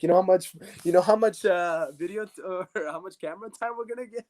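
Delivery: high, laughing conspiratorial voice; giggling scheme. Retold or imitated. Teammate explaining the camera plan, laughing and high. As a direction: let the laugh break through the plan.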